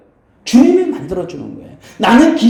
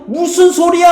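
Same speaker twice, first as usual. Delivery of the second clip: high, louder indignant retort; mock shout back at the crowd; defiant outcry; 11 semitones higher, 4 dB louder than usual. Beggar refusing to be silenced, high defiant cry. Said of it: "더욱더 어떻게 소리를 크게 질렀다".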